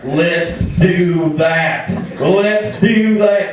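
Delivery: very slow, stretched Southern drawl; milk every word of the catchphrase; drawn-out catchphrase. Acted. Rusty hypes the crowd with his catchphrase, slow drawl.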